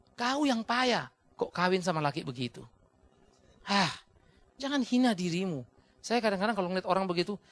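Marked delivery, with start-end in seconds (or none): none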